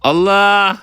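high, loud, drawn-out cry; startled gamer yell; alarmed exclamation. Real, not acted. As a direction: cry out as danger hits. Sudden danger in game.

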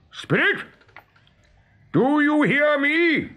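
high, slow challenge to a spirit; cried summons. A narrator's reading. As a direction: call out into the distance. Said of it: "he stood up and cried".

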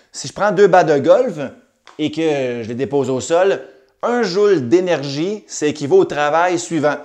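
usual speaking voice

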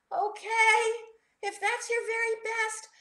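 high, reluctant storybook voice; mildly worried little character giving in; doubtful. A narrator's reading. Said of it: "Rocky said doubtfully".

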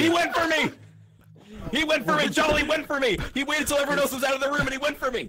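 high-pitched, breakneck outcry; frantic accusation. Real, not acted. Player reporting an attack once the room emptied, frantic. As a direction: insist urgently in a high, worked-up voice.

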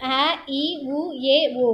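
அ, இ, உ, ஏ, ஓ recited as brief vowels; short vowels. Shown as a demonstration. Teacher reciting the short letters in alphabetical order, brief vowels. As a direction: keep each of அ, இ, உ, ஏ, ஓ short. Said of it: "இதுதான் அதனுடைய அகர வரிசை குறில் எழுத்து".

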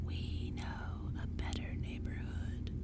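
slow, hoarse creature whisper; crackling whisper. A narrator's reading. Pumpkinhead luring the trick-or-treaters onward, crackling in a whisper. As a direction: whisper it dry and drawn out. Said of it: "the first Pumpkinhead crackled".

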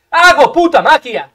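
loud, drawn-out triumphant brag; shouted boast. Real, not acted. Gamer bragging about his play, long loud shout. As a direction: stretch it out and shout it. Boasts again, bigger take.

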